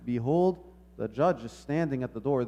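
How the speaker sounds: ordinary talk, nothing marked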